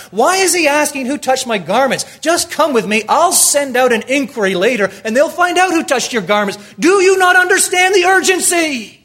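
loud, high frantic plea; desperate father pleading. Pretend. Father Jairus begging Jesus to hurry home, loud and frantic. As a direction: beg him to hurry, voice cracking with urgency.

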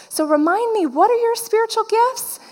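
high-pitched imitation of a friend asking; friend's innocent question. Friend who forgot asks at a second lunch, innocent high-voiced question. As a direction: lift the voice high, imitate her blithely asking.

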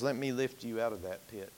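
soft, low, gentle offer; a quiet rescuing voice; tender Jesus. Acted. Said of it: "Jesus comes along and says".

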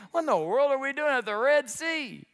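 lightly acted disbelief, pitched high; bewildered Moses. Moses finding himself at the Red Sea, bewildered. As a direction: question it in mild mock dismay. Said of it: "Moses has gotta be thinking".

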